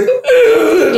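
high choked sob sound; a crying catch in the throat. Retold or imitated. Woman judge chokes up crying, a high sob. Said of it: "at this point".

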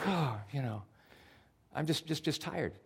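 weary sigh. Retold or imitated. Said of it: "And you're like".

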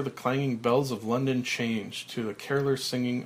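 usual speaking voice

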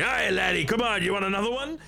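high, fast Scottish taunt; Scottish monk taunting the monster; Scottish brawler. Acted. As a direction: goad the bugbear in a Scottish brogue. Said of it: "He's like".

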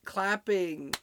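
normal talking voice

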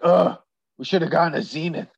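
fast grousing groan of a sour neighbor; grumpy neighbor. Retold or imitated. Host imitates the husband answering his wife, quick sour grumble. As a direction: grumble it under a sigh. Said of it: "he's just like".

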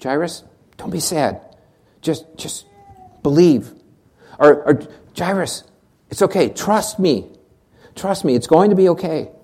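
soft, tender reassurance; gentle comfort. Pretend. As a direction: comfort a grieving father quietly. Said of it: "I would have expected Jesus to say something like".

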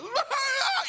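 high, hammed-up wail; mock ugly crying. Shown as a demonstration. Preacher demonstrating audible ugly crying, exaggerated wail. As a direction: fake a noisy, ugly sob. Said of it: "it's, like, visual and audio".

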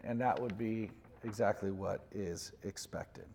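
ordinary talk, nothing marked

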